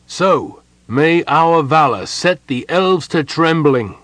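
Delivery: hearty officer's battle boast; rousing battle cheer. A narrator's reading. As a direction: proclaim it boldly to the troops. Captain rallies troops before battle.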